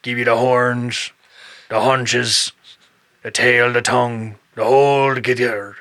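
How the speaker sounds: a grizzled shark hunter bargaining; Quint from Jaws. Retold or imitated. Quint from Jaws offering to kill the bull. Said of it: "like Quint from Jaws".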